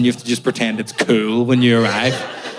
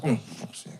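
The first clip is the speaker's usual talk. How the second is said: muttered curse; 13 dB quieter than usual. Retold or imitated. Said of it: "I was like".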